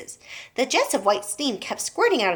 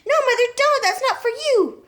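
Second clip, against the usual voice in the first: alarmed plea; 5 dB louder than usual. A narrator's reading. Daughter stops mother.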